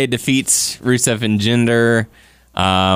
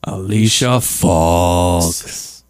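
slow drawn-out announcer; 4 semitones lower, much slower than usual. Pretend.

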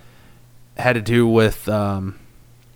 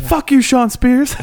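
mock angry quote; 13 semitones higher, 4 dB louder, slower than usual. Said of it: "It's like".

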